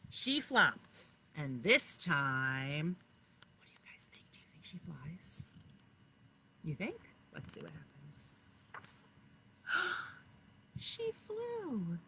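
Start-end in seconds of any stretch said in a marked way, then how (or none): 3.6-5.4 soft unvoiced question; whisper. Real, not acted. Teacher whispering a suspense question to the class. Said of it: "teacher asking in suspense". 7.3-8.3 hushed, secretive; suspense whisper. Real, not acted. Teacher whispering before revealing the ending. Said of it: "teacher building suspense". 9.7-10.1 sharp, high gasp of delight; surprised gasp. Acted. Teacher gasping in delight as the chick flies. Reveal she flew.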